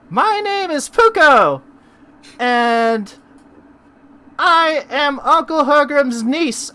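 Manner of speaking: put-on very high, slow little girl; a shy tiny girl introducing herself.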